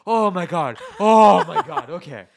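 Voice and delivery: loud, gasping oh my god; shocked relief. Retold or imitated. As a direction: catch your breath in disbelief. Relief after near-accident.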